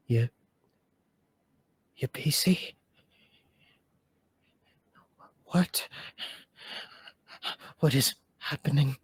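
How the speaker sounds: lightly done weary, groaning voice; haggard groany whisper. Acted. Same voice waking confused, a light haggard groan. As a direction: sound drained and confused. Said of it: "whisper, but it's more haggard and groany".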